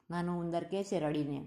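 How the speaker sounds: ordinary delivery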